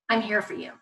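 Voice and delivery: soft, quiet support; tender reassurance. Shown as a demonstration. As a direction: comfort the child gently. Models supportive reply to child.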